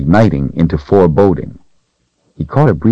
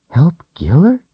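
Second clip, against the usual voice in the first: put-on higher, questioning young girl; puzzled little girl; 12 semitones higher than usual. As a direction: echo it back, confused. Rachel echoes the doll puzzled.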